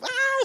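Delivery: short high squeal of a startled wife; mock scream. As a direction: squeak out a jumpy little scream.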